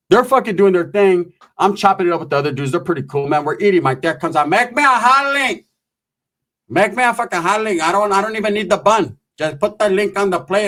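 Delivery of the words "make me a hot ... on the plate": demanding relative. Retold or imitated. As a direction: imitate a relative ordering food. Relative comes out demanding food.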